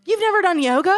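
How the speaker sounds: high, loud disbelief; can't believe what you just heard; real surprise, voice jumping up; shocked. Real, not acted. Shocked at friend's confession.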